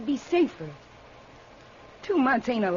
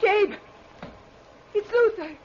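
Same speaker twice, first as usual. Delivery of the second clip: she sees a ghost and shrieks; frightened shouting at the window; terrified; 7 semitones higher than usual. Acted. Dead husband seen returning.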